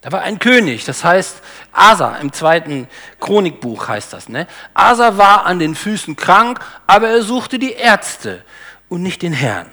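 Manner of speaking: ordinary talk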